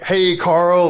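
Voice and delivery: loud, high Jersey-bro greeting. Retold or imitated. Brash American greeting a fashion icon, loud Jersey bro. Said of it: "totally Jersey broed out".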